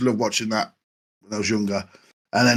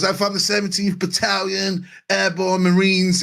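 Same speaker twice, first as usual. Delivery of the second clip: hammed-up military boast, higher; imitating Robert, higher; 6 semitones higher than usual. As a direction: puff it up like a fake marine. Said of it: "he goes".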